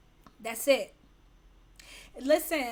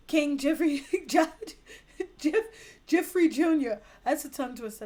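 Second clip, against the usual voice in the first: usual speaking voice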